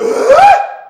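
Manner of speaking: sudden very high, loud shriek; imitated scream. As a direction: shriek out of nowhere like a schoolkid. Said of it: "just screams out".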